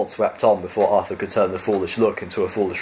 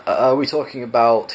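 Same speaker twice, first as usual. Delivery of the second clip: ordinary delivery, nothing marked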